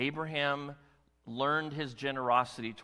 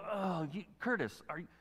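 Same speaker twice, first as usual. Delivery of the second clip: complaining listener; 4 semitones higher, 5 dB quieter than usual. Pretend. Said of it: "all we gotta read to go".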